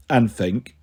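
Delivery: plain reading voice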